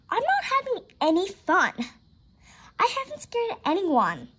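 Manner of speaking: put-on high, whiny-kid character voice; complaining girl. A narrator's reading. Katie complains to friends on Halloween, high complaining voice. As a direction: raise the pitch and grumble.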